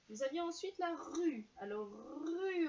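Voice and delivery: announcing the next word to the class; bright announcing. Shown as a demonstration. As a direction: present the new word with a lift. Teacher lifts voice to announce word.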